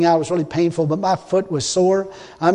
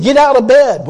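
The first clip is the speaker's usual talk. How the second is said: louder, higher retold order; retold command; 5 semitones higher, 7 dB louder than usual. God ordering a sick man up, retold forceful command. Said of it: "He said".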